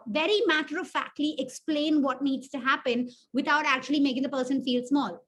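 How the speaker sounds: ordinary delivery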